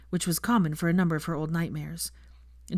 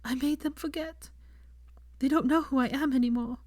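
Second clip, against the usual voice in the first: sobbing; 9 semitones higher than usual. A narrator's reading. Sobbing confession continues.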